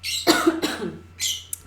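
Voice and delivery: rough coughing, clearing the throat; coughing fit. Real, not acted. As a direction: hack as if something is stuck. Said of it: "I think I swallowed a bug just now".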